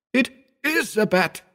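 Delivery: high, weeping admission; choked with terror; sobbing. A narrator's reading. Howard confessing what he sees, high and sobbing. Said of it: "sobbed Howard".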